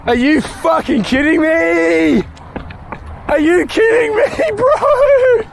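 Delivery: high, drawn-out incredulous whine; mock-crying wail. Real, not acted. Photographer missed the car, crying-out wail. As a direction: wail to the heavens, breaking into laughter. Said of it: "Man Cries for One Year".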